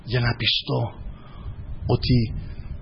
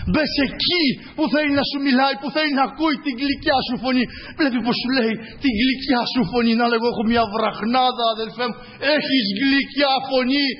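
raised, driving preacher's voice with rising calls; fervent preaching; 9 semitones higher than usual. Acted. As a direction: preach it at full force to the congregation. (ένθερμο κήρυγμα με δυνατή φωνή)

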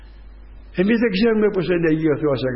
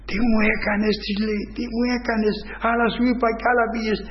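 higher, reproachful retold outburst; angry lawyer; 7 semitones higher than usual. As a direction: scold her for going off script. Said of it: "λέει ο δικηγόρος στη γυναίκα μου".

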